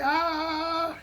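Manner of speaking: long, high mock holler; imitated kids' hollering. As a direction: mimic distant kids hollering at reduced volume. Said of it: "I heard, like, this hollering".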